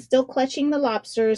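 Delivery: ordinary delivery, nothing marked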